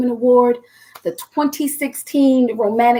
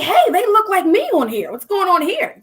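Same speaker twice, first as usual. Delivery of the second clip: put-on higher, amazed girl's voice; excited teen; 6 semitones higher than usual. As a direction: squeal it like a teen seeing herself on a cover. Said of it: "And I was like".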